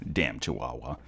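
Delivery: ordinary talk, nothing marked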